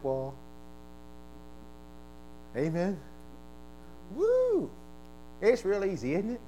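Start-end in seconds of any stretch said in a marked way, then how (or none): 4.2-4.7 high whoop. Real, not acted.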